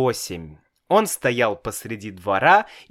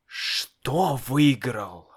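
stunned question; much slower than usual. A narrator's reading. Father stunned, slow.

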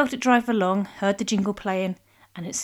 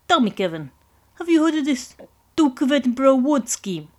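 Italian mob boss; 5 semitones higher, 5 dB louder than usual. Acted.

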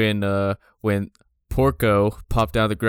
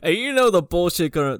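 normal talking voice

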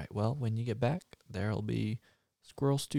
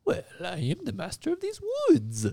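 high, grand voice of the woods' master; mystical forest master; 5 semitones higher, 8 dB louder than usual. Acted. The master of the woods greeting a lonely man in a high grand voice. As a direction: announce yourself as lord of the forest.

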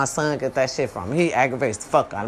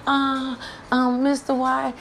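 higher put-on teacher voice, hesitant then insistent; imitating the teacher; 8 semitones higher than usual. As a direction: play the flustered teacher taking over. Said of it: "and teacher like".

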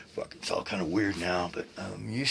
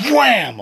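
explosive loud, high "wham"; imitated impact wham; 13 semitones higher, 13 dB louder than usual. Man retelling landing on his left side, imitated crash. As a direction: burst out the crash of hitting the ground.

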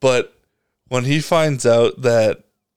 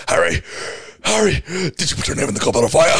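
rushed, frantic, breathless demand; Dumbledore; 3 semitones higher, faster than usual. Retold or imitated. Mimicking the movie's aggressive version, frantic demand. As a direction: fire the question off fast and urgently. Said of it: "fucking running down the stairs".

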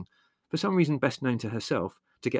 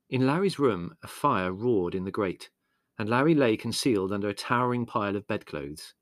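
ordinary delivery

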